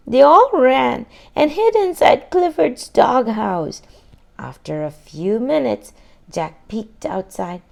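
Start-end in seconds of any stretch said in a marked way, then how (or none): none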